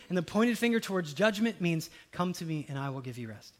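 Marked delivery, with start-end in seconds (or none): 2.1-3.5 gentle invitation. Acted. Tone change to gentle.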